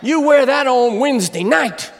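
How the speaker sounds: loud, high, excited exclamation; bowled over by his wife's looks; delighted whoa. Retold or imitated. Husband wowing his wife before prayer meeting, excited. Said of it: "leap to your feet and say".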